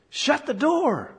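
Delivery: high, drawn-out irritated order; irritated father. Acted. Dad seeing a door left open, irritated. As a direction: snap it high, stretching the words in exasperation. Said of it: "we get irritated".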